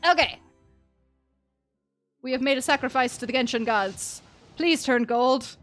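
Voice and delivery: usual speaking voice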